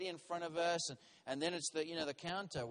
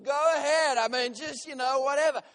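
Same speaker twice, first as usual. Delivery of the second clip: loud, high, dismissive concession; exasperated giving in; 6 semitones higher, 10 dB louder than usual. Retold or imitated. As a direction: give up loudly, voice raised.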